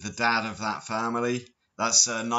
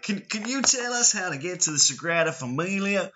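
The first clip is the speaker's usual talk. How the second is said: high-pitched American tourist impression; mock American tourist; 9 semitones higher than usual. Imitating tourists asking him for metro directions, mock American accent. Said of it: "and they're like".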